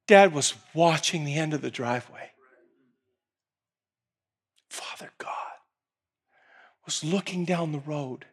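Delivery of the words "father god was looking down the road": soft, low telling; hushed narration. A narrator's reading. Preacher softly telling of the watching father. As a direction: draw them in with a hush.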